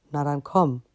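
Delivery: a touch of the big dragon inviting; deep dragon voice. Acted. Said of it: "sagte die tiefe Stimme". (angedeutete tiefe Drachenstimme)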